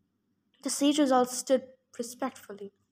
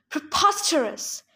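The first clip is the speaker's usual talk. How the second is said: bellowing; 3 semitones higher than usual. Acted. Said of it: "Silenus bellowed".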